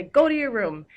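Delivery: "Go to your room" shouted high and loud, stress on go; exclaimed command. Shown as a demonstration. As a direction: bark it as an angry order. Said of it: "that would end with an exclamation point".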